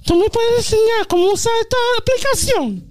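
very high Spanish-speaking mom voice; old mom voice. Retold or imitated. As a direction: plead for app help like an old mother. Imitating mom asking in Spanish.

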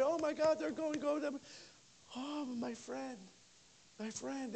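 high, soft, tearful lament; mock tearful cry. Pastor imitates women tearfully saying goodbye to a friend.

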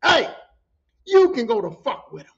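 loud, indignant dismissal in a retold quarrel; angry telling-off. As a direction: send him packing with a loud, punchy opening. Telling off a man, acted.

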